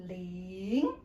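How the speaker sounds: "ling" starts low and climbs; rising second tone. Shown as a demonstration. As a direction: draw out "ling", sliding up like a question. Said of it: "start lower and then go up".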